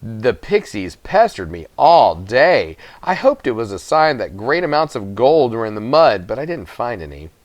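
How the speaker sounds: usual speaking voice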